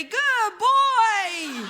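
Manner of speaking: put-on a high, loud good-boy coo; a hint of dog-owner praise; pet praise. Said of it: "I heard myself say".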